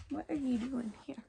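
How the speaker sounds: soft whispered question to the dog; whispering to the pet. Real, not acted. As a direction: hush it out under your breath.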